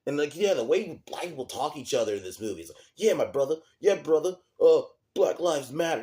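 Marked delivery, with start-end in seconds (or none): 3.0-6.0 much higher and louder, hammed-up yelling; mock screaming caricature. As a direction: shout the slogans like a bad parody of the film's dialogue. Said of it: "just randomly screaming it".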